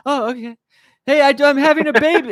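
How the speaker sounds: high, quick blurted announcement; high flustered announcement; awkward news blurt. Acted. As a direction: rush out big news, pitched high. Acted awkward exchange continues.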